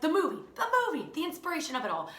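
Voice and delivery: fast, high burst of realization; hammed-up eureka, rushing the words; mock dramatic realization. Remembering the Elf movie mid-panic, mock dramatic.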